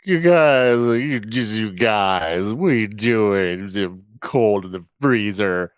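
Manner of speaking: put-on slow, drawn-out drunken pleading; faked drunkenness. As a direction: keep up the drunk pilot act, words dragging. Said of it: "I'm still maintaining the".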